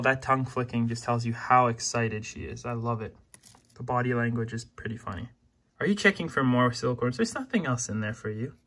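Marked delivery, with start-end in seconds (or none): none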